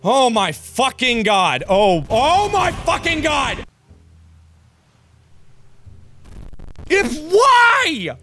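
real frustrated gamer rage; furious shout. Real, not acted. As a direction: yell at the game over and over. Raging after repeated deaths.

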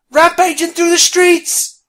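high, loud, drawn-out alarm; panicked yell. Acted. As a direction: yell a slow, piercing warning to everyone. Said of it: "she yelled".

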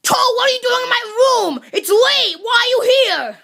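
high, agitated demand; alarmed, irritated outburst; startled, indignant. Acted. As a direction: demand answers, just jolted awake. Startled awake, demanding an explanation.

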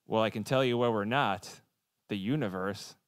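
normal talking voice

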